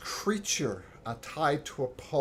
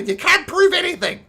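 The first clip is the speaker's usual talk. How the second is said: high, loud goblin protesting innocence; much higher shouting goblin; 12 dB louder than usual. Acted. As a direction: squawk denials like a cornered goblin. Goblin denies theft.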